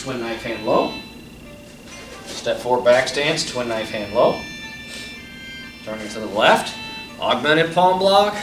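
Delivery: crisp called-out form commands; sharp martial arts commands. Shown as a demonstration. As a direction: call each move like a drill. Calls form movements over music.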